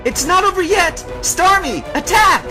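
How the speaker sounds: high-pitched, loud command to attack; battle shout. Acted. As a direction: yell the order at full force.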